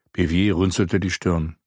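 ordinary delivery

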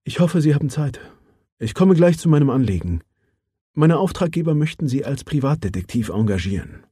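lightly voiced businesslike woman; Mara Johansson. Mara speaks to Felten. (sachliche Frauenstimme, leicht angehoben)

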